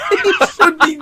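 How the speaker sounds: giggling, pitch way up; laughing through words. Real, not acted. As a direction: laugh as you talk. Laughing while describing.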